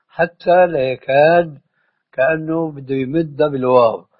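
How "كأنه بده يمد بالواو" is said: ordinary talk, nothing marked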